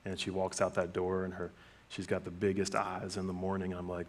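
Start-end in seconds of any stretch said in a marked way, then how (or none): none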